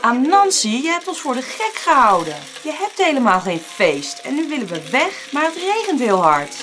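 loud, high protest; aggrieved complaint from a cheated crowd; indignant. A narrator's reading. Duped animals complain to Anansi.

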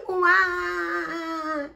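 high, loud, long piglet wail; baby wail. Acted. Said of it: "wailed Baby Pig".

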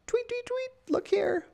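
very high, tweeting birdy voice; little bird calling the reader over; chirping bird. Pretend. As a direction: chirp it like a tiny songbird. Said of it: "This bird is like".

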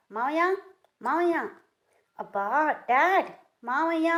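high, loud teasing calls; mock shouting. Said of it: "నేను కూడా అరుస్తా". (ఆమెను వెక్కిరిస్తూ మావయ్యను గట్టిగా పిలవడం)

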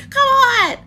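high loud plea; exasperated high call; pleading shout. Real, not acted. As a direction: beg loudly for it. Begging for a code.

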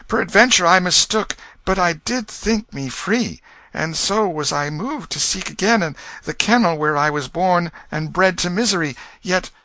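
high, earnest apologetic explaining; humble boy. A narrator's reading. Tom explaining to the king his wish for home, humble high voice. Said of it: "and answered humbly".

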